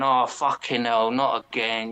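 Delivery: low, slow sighed-out groan; weary sigh. Retold or imitated. Dick sighing at Vito going off on one, low weary groan. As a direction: let it out as a tired, fed-up sigh. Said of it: "Dick starts sighing and going".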